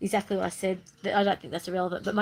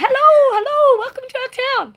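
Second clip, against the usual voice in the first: much higher, loud cheery greeting; warm welcome; 12 dB louder than usual. Retold or imitated. Townsfolk greeting the camper van, high loud cheer. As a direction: call out a delighted welcome. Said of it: "we got treated like".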